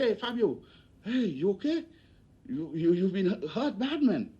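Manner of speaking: high, alarmed concern; concerned officer. Retold or imitated. Officer recognizing injured friend.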